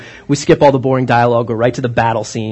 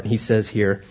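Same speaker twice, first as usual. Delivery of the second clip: ordinary delivery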